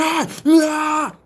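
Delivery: high mock screaming. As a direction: imitate someone screaming in all caps. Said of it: "you're screaming. You're going".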